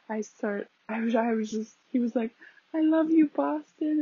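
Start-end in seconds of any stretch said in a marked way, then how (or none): 2.7-4.0 calling to the crowd. Retold or imitated. Artist calls out to crowd.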